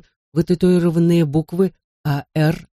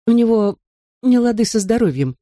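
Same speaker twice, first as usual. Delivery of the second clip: nervous smile; 6 semitones higher than usual. Acted. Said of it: "с несколько нервной улыбкой".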